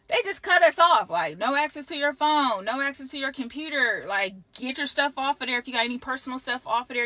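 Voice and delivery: high, loud rattled-off orders; indignant retelling. Retold or imitated. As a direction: fire off each cutoff like another jab. Indignantly retelling abrupt cutoff orders.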